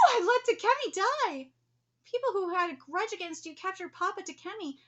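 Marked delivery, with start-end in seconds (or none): 0.1-1.5 loud, slow, high anguished outcry; anguished cry. Acted. Ryu crying out his guilt, loud and slow.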